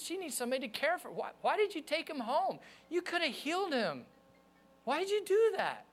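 high-pitched retold protest, pleading why; mad at God. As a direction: re-enact an anguished demand for answers, pitch soaring. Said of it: "I was so mad at God".

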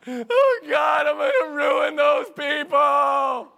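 high, loud, slow panicked shout; loud panicked wail; freaking out. Retold or imitated. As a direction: cry out in mock terror. Retelling panic at the call.

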